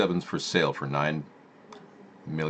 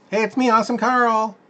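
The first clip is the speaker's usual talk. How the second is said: very high put-on cartoon voice, louder; Awesome Carl voice; 5 dB louder than usual. Host greets viewers as the silly Awesome Carl character. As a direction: squeak it out like a goofy puppet.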